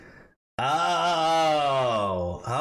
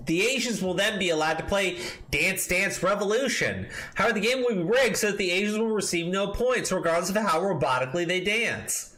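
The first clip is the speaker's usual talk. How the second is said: high, raised reading voice; mock pompous reading; 8 semitones higher than usual. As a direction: read it like a smug manifesto. Reads article quotes in a put-on voice.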